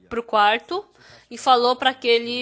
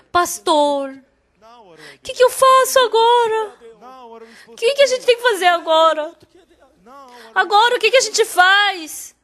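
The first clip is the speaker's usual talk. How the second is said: grito aflito, bem mais alto e agudo; desesperada gritando; 9 semitones higher, 8 dB louder than usual. Retold or imitated. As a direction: imitando a esposa em pânico ao telefone. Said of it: "a esposa dele começou a gritar". (esposa grita desesperada ao pastor)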